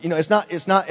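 ordinary talk

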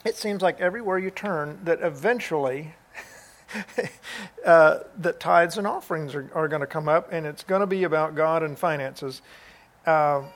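usual speaking voice

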